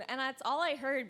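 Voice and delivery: ordinary talk, nothing marked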